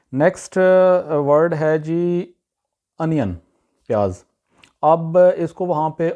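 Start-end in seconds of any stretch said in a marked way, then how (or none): 3.0-3.3 "انین" opening on short "uh": UN-yun; correct pronunciation. Shown as a demonstration. Teacher introduces the word onion, correct pronunciation. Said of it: "اس کی صحیح pronunciation ہے".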